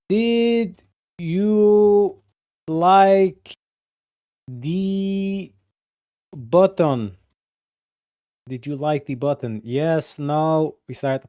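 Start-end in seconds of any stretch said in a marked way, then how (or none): 0.1-7.2 slow, high, stretched question; drawn-out poll voice. Pretend. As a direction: stretch each word like an announcer. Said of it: "Do a poll".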